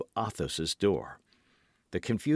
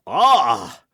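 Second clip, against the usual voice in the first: much louder sudden shocked cry; stricken cry; 2 semitones higher, 11 dB louder than usual. A narrator's reading. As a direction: gasp out as if shot in the heart. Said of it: "cried the Musketeer".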